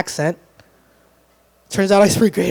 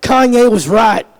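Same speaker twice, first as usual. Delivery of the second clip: gun-aisle tough guy; 4 semitones higher, 6 dB louder, slower than usual. Acted. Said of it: "this side is like".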